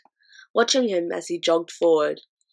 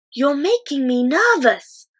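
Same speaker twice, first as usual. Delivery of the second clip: put-on higher, slow, anxious girl; nervous girl; 7 semitones higher, 6 dB louder than usual. A narrator's reading. Sophie frightened in the pocket, nervous girl voice. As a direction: let the fear creep in.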